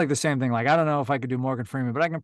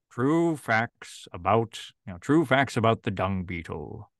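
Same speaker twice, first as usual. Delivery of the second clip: mock Morgan Freeman; slower than usual. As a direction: draw it out like a grave nature documentary. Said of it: "I can probably do a Zay Frank impression of Morgan Freeman".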